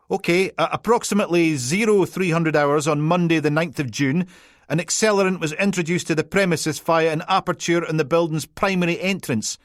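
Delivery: high, loud Peterhead police-report jargon; officious Peterhead PC. Acted. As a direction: recite it like evidence in court, broad Peterhead. PC reading formal report.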